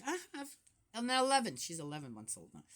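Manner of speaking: normal talking voice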